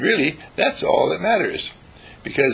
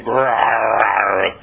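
dog growl; 3 semitones lower, 4 dB louder than usual. Retold or imitated.

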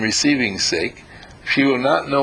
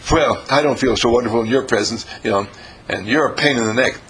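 grumpy lady. Retold or imitated. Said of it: "And the lady says".

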